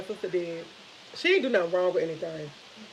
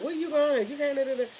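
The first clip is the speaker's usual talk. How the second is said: imitating mom's fussing, pitched up; mildly fussy, higher-pitched mother; mock motherly fit; 5 semitones higher than usual. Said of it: "My mom have a fit".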